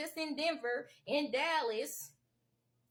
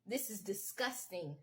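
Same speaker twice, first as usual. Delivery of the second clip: ordinary talk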